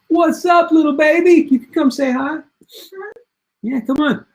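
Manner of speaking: high sweet voice for the little one. Real, not acted. Greeting his little son on stream.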